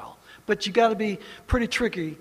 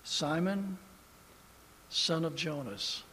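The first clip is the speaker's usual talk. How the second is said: tender Jesus addressing Simon; 2 semitones lower, 6 dB quieter than usual. A narrator's reading. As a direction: speak it like a tender rebuke. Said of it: "very tenderly".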